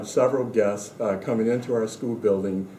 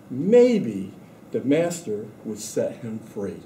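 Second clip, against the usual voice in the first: story reading; 3 semitones higher than usual. A narrator's reading. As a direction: read the picture book aloud to the class. Reads picture book to students.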